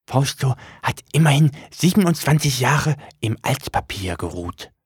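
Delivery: high, odd little demon voice; high demon voice, proud of itself; book demon voice. Acted.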